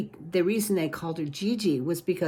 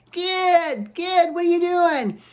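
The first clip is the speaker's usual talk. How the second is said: high, louder calling voice; calling little brother; 11 semitones higher, 4 dB louder, slower than usual. A narrator's reading. Big brother calls to Gid upstairs, high louder call. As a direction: call out to the noisy kid.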